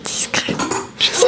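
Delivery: whispered echo of the word; breathy ASMR repeat, right in your ear. Pretend. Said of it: "je vous fais de l'A S M R".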